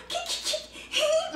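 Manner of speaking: put-on high, loud child's snicker; imitated giggle. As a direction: mimic a child giggling to be noticed. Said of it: "막 이렇게 하고 웃고". (아이가 킥킥 웃는 흉내)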